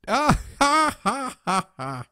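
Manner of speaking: high, loud fake laughter; hammed-up laugh. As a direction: cackle at your own joke, overdone. Guru laughing at own joke.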